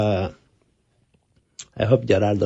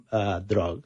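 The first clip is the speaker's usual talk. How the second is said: normal talking voice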